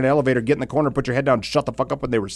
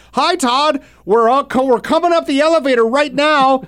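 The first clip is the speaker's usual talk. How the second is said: high, loud phone yelling, imitating the new kid; screaming into phone; 12 semitones higher, 4 dB louder than usual. New kid answers Todd's call in the elevator, mock loud yelling. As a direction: bellow cheerfully into the phone right by someone's ear. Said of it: "Just screaming in my ear".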